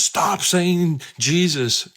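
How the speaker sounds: high, slow, drawn-out demon voice. Retold or imitated. Demon telling the praying preacher to stop, high and slow. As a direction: stretch every word like something inhuman. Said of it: "this demon keeps speaking to us".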